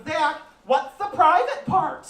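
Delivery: put-on young daughter asking her stepdad innocently; curious kid. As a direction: ask it wide-eyed like a small child. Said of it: "she goes".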